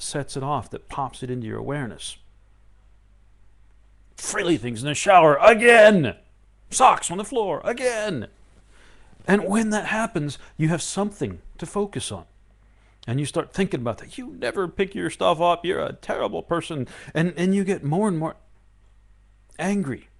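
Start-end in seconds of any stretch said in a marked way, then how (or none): 4.2-8.3 loud, high fed-up outburst; hammed-up household gripe; exasperated. Exasperated angry complaint acted. 14.2-16.9 snipe at them, a little hammed-up; acted blame aimed at the partner; mock accusation.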